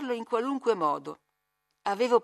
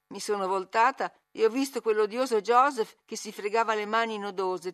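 usual speaking voice

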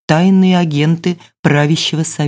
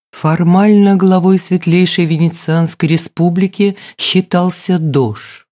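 ordinary delivery

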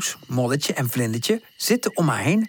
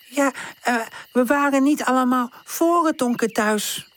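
higher, halting little dog voice; stammering; 11 semitones higher than usual. Acted. Woezel admitting to tante, stammering. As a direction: falter through the words. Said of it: "stamelt Woezel".

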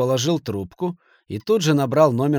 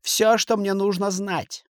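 shouting; 9 semitones higher, slower than usual. A narrator's reading. Kasimov keeps yelling at the banker, high and slow. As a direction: keep shouting, pressing each word. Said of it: "закричал Касимов".

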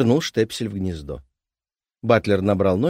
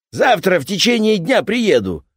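loud, high rough workman voice; gruff repairman; 11 semitones higher, 8 dB louder than usual. Acted. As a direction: bark it like a pushy repairman. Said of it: "объявил дядька".

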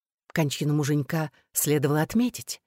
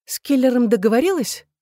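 higher, pressing question; impatient questioning; 6 semitones higher, 3 dB louder than usual. A narrator's reading. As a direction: demand the answer, losing patience. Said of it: "не выдержала я". (нетерпеливо, с напором)

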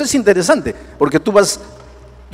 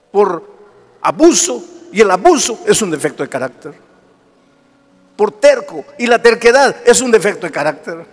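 preaching; 4 semitones higher than usual. Acted. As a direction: preach it with rising fervor. Preaching at full force.

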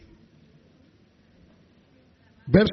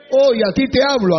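higher and louder, pointed; loud confrontation; 4 semitones higher, 6 dB louder than usual. Acted. Pastor scolding an abusive husband, loud. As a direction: call him out.

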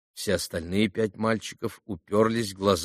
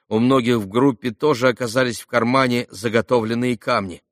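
ordinary delivery

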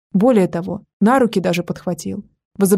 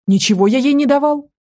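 higher, louder indignant denial; 6 semitones higher, 4 dB louder than usual. Acted. Beaten boy denying he gave her anything, indignant and higher. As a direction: protest being accused. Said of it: "возмутился Костя".

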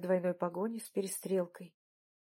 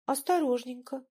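higher, dainty little excuse; self-justifying; 4 semitones higher than usual. A narrator's reading. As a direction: add an excuse in a small voice. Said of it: "оправдывалась Мария Аркадьевна". (оправдываясь, тонким голоском)

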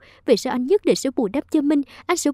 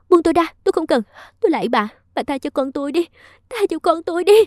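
higher, louder cry for mercy; desperate shout; 6 semitones higher, 4 dB louder, faster than usual. Acted. Minh screams at Bà Dung to spare her child, loud and high. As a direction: scream to be let go and beg. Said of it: "Minh hét lên".

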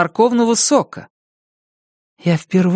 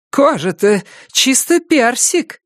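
doting grandpa; 7 semitones higher, slower than usual. Acted. Grandparents cooing over baby.

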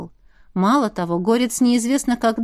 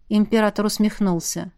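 usual speaking voice

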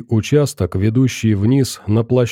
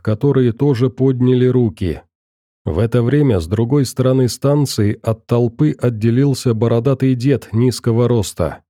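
usual speaking voice

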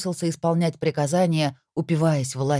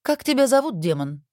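lightly voiced imperious young queen; a hint of a haughty queen; 9 semitones higher, 3 dB louder than usual. A narrator's reading. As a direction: ask like a queen testing a servant. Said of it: "спросила Анжелика".

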